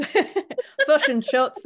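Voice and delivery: drawn-out imitation of a Kiwi accent; mock New Zealand accent. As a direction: tease the New Zealand vowels, slowly. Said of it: "is what they'd say".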